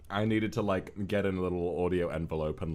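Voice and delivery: ordinary delivery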